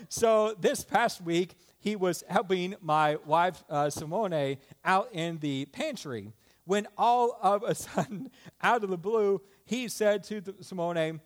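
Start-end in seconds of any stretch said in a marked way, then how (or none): none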